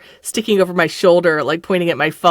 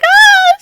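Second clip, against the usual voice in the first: very high, loud shriek; mock scared girl screaming; terrified scream; 10 dB louder than usual.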